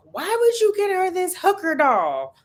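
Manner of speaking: high, indignant auntie voice; mock outrage at the stepmom; upset auntie. Favorite auntie scolding the stepmom at the birthday party, indignant. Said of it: "was upset with my stepmom and was like".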